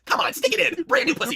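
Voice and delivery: very high, loud urging; Nia. Acted. Nia hurrying Nose Man along, high loud urging. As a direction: goad impatiently in a put-on high voice. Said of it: "Nia lets Nose Man hit".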